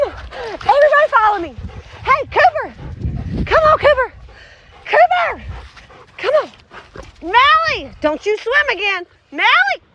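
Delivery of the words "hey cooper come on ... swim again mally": calling dogs. Real, not acted. Calling dogs across yard.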